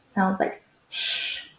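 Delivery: "sh" as one long hushing sound. Shown as a demonstration. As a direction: hold a soft shh like hushing a room. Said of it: "only make one sound".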